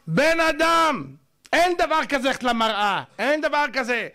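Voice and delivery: high, stretched call, then firm insistence; emphatic admonishing. Acted. Lecturer telling gym-goer to stop checking the mirror, emphatic admonishing.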